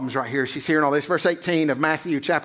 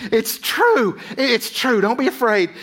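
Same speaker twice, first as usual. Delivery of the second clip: eager angel; 6 semitones higher than usual. Retold or imitated. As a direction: play the angel urging Joseph in a dream, high and pressing. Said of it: "and he's like".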